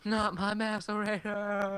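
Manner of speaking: high, drawn-out mock wail; mock anguish. Friend joining a joke about stolen gear, mock-anguished wail. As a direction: wail it slowly in hammed-up despair.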